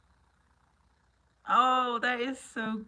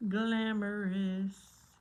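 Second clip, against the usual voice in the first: Glamorous stretched very slow and savoured; drawn-out glamorous; much slower than usual. Shown as a demonstration. Streamer showing off new nails, drawling Glamorous slowly. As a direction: linger over Glamorous like a film star.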